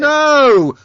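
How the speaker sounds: high, very loud cry of no; loud protest. Real, not acted. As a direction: shout to cut it off. Interrupts a wrongly played clip.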